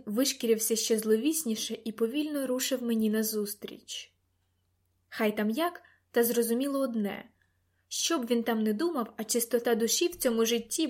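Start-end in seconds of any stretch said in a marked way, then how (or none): none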